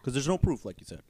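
talking through a burp. Real, not acted. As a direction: burp while speaking. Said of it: "Sorry, I'm burping".

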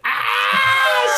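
high, loud cry of horror; horrified scream. Retold or imitated. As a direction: scream at the explosion. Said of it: "he's like".

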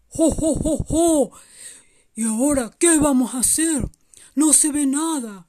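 voz fuerte de Santa, algo preocupado; un jo, jo, jo sonoro y luego inquietud leve; Santa Claus preocupado. Acted. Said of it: "Santa Claus estaba preocupado". (Santa Claus preocupado)

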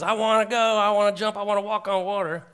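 bursting to go, like Peter stepping out; eager Peter. Pretend. Preacher voicing eager Peter wanting to walk on water. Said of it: "So you might be like Peter".

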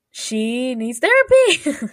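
mock-exasperated yell, drawn out; joking outburst. Friend joking about a TV character, loud mock outburst. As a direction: blurt it loudly, stretching the words.

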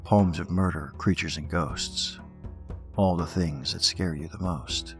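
slow, hushed, sinister host welcome; horror host. Acted. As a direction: draw the fiends in slowly. Spooky pub host intro.

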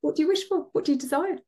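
high, playful genie asking your wish. Pretend. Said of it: "like a genie's just come out of the lamp".

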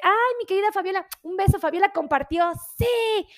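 high-pitched, excited cheer; delighted. Real, not acted. Host thanking Fabiola for sharing, delighted cheer. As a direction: break off into a gleeful shout-out, topped by a high "sí".

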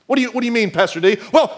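ordinary talk

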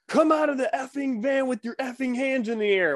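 hammed-up trooper yelling his commands; mock cop shout. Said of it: "And he's like".

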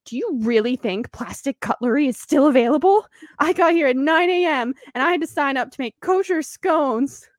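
lightly voiced snooty mom; a hint of a smug rival mom; quoting another mom. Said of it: "she's like".

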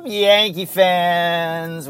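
loud, drawn-out holler to the fans; hyped-up podcast host's greeting call; rousing show opener. Real, not acted. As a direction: stretch the greeting out big and loud. Host opens show calling out.

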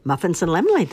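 usual speaking voice

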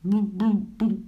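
short popping blubs; bubbling noises. A narrator's reading. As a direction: imitate bubbles from underwater. Imitates bubbles underwater. (korte borrelgeluidjes)

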